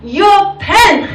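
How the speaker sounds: high, slow, emphatic learner's recital; mock-earnest schoolbook sentence; textbook English answer. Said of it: "I have to learn English like".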